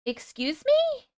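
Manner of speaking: high, slow, incredulous excuse me; surprised. Real, not acted. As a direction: stretch it out in disbelief. Surprised at a strange item.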